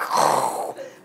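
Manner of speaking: long rushing rocket roar made with the breath; imitated missile whoosh. Shown as a demonstration. Showing an audience how a missile comes up, imitated whoosh. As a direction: mimic a missile blasting off.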